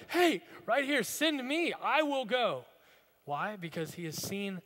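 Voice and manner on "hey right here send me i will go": high, loud eager call; Isaiah volunteering, loud and eager. Retold or imitated. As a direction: shout it like a kid picking himself. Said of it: "I just picture Isaiah like".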